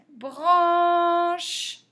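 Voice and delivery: "Branche" stretched high and loud on its vowel; sounded out. Shown as a demonstration. Teacher repeating the word for spelling, "Branche" drawn out. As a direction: hold the "an" of "Branche" long.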